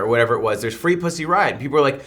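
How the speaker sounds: ordinary talk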